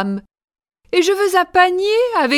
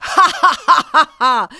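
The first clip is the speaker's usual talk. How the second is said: wicked laugh; faster than usual. Acted. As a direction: cackle in triumph. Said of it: "La reine rit".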